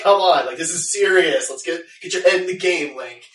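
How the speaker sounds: mock-impatient telling-off; Zelda, exasperated scolding. Cartoon princess scolding the hero to be serious, exasperated.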